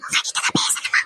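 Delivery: hushed, voiceless aside; whisper. Acted. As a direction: whisper so the caller can't hear. Whispered aside during phone call. (கிசுகிசுப்பாக)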